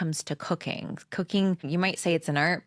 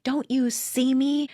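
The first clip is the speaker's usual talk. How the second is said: hurt, mad plea; 7 semitones higher, slower than usual. Retold or imitated. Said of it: "being mad".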